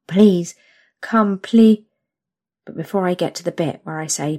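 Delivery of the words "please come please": higher, louder desperate pleading; loud begging. Acted. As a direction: cry out the plea. Begging to come.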